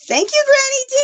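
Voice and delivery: very high excited thank-you; celebratory cheer. Real, not acted. Streamer celebrating a generous donor, celebratory cheer.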